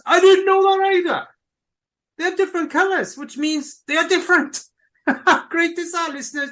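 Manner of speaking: high, loud, amazed gush; excited. Real, not acted. Excited discovery about organ colors.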